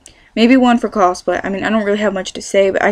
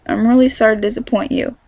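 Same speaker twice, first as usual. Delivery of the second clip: ordinary delivery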